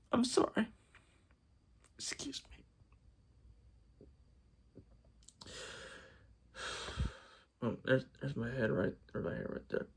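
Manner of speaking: choked, whispered apology through real crying; breaking down in tears. Real, not acted. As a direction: voice gives out, barely a breath of sound between sobs. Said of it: "I'm getting emotional here".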